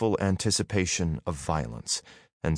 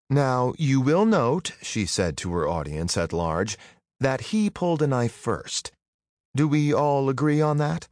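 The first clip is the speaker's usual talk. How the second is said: mocking announcement; 6 semitones higher than usual. A narrator's reading. Girl addresses crowd.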